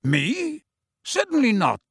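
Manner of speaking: high-pitched, surprised denial. A narrator's reading. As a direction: sound taken aback, voice leaping up. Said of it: "looking surprised".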